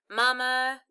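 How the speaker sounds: plain reading voice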